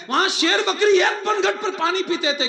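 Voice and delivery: high, forceful preacher's cry; impassioned contrast. Real, not acted. Preacher contrasting Umar's era with today's, in a high impassioned voice. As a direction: raise the pitch and drive the contrast home.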